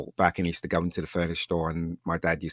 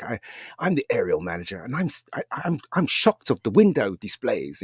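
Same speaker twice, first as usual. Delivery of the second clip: snooty manager; 3 semitones higher than usual. Retold or imitated. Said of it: "this really snooty guy".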